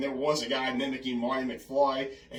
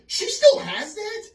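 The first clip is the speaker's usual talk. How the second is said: high, loud, drawn-out incredulous squeal; astonished reaction; 11 dB louder, slower than usual. Retold or imitated. Brother hearing about Grandma's tape, imitated loud astonishment. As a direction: imitate a brother's amazed question, voice shooting up. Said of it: "he had the same reaction".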